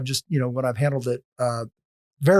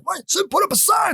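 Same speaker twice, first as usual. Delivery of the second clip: high-pitched mock shout; retold yelling. Man in checkout line yelling at little old woman, mock shout. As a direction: imitate an angry man yelling. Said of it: "he starts yelling at her".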